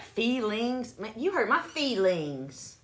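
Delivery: "feelings" as exaggerated "fee-lings"; doubtful standard pronunciation. Shown as a demonstration. It don't sound right, incredulous drawn-out "fee-lings". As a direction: overdo the long ee and ng in "feelings". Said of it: "That don't even sound right".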